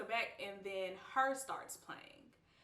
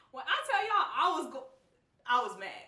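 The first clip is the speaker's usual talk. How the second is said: laughing emphasis; 5 semitones higher, 9 dB louder than usual. Real, not acted.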